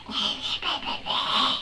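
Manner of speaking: voiceless gushing blah-blah noise; garbled blubbering noise. Real, not acted. Reader swooning over a swearing man, garbled gushing noise. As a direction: dissolve into breathy, wordless babble. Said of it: "I don't know what that was".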